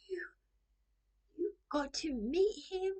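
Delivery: high-pitched retold astonishment; can't believe she met him; amazed disbelief. Retelling own amazed reaction.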